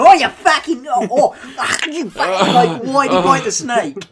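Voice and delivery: loud, high, hammed-up Australian; spluttering Australian outrage, half laughing; Australian accent. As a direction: yelp it like a riled Australian. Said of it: "I imagine to be Australian".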